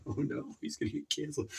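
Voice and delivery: ordinary talk, nothing marked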